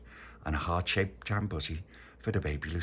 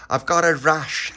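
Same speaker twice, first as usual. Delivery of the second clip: loud, high, drawn-out protest; acted childish huff; indignant excuse; 8 semitones higher, 10 dB louder than usual. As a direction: blurt it stubbornly and slowly. Tony's excuse for refusing.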